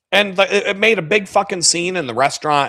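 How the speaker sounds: ordinary talk, nothing marked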